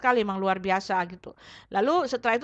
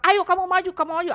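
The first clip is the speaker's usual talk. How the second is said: higher-pitched retold urging; 7 semitones higher than usual. Another inner voice telling her to come forward, insistent urging. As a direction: imitate someone egging her on.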